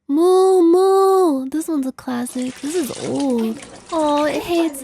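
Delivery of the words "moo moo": loud, long-drawn mooing cat; cat imitation. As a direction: moan it out like a cat in the bath. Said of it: "taking a bath going moo moo".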